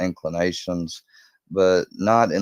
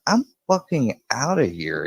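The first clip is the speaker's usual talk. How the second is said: higher-pitched retold exasperation; mock-indignant, pitched up; fed up; 7 semitones higher than usual. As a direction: act out storming off in annoyance. Retold quarrel, hitchhiker storms out.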